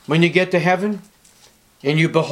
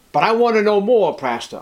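mock congregant's plea; 8 semitones higher, 5 dB louder than usual. Voicing a frustrated congregant.

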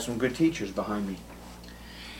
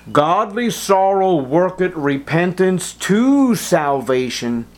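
higher, louder, slow preaching; emphatic verse reading; 7 semitones higher, 6 dB louder, slower than usual. Acted. Preacher proclaims the key verse to his congregation, slow and loud. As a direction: proclaim every word deliberately.

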